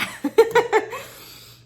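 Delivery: high burst of laughter. Real, not acted.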